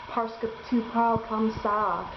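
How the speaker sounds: imitating an American-accented French, lightly; French said with a flat American accent. Classmate mocks the American speaking French, in a light American accent. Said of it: "exactly like an American speaking French".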